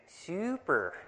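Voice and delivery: imitated Californian "super", softly drawn out; Californian accent. Australian woman mimicking how Californians talk, Californian "super". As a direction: stretch "super" as Californians supposedly do. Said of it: "How do they know we sound like Californians?".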